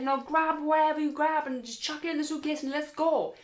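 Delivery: high, hurried-sounding retold orders; panicked urgency. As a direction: order a friend out the door in alarm. Panicked packing orders after oversleeping.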